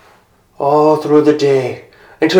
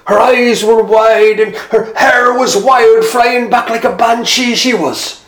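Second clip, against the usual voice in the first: high, loud, wide-eyed telling; excited storyteller; 7 semitones higher, 4 dB louder than usual. Acted. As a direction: marvel at it out loud.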